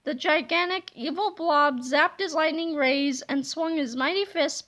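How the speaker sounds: ordinary delivery, nothing marked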